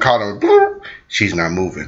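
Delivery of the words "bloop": high imitated phone bloop; phone sound. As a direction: pop out a phone noise.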